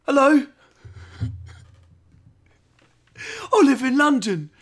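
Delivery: high, loud, exaggerated posh British; mock British accent. Streamer mocking a British voice actor, exaggerated high British accent. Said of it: "I'm just making fun of his voice".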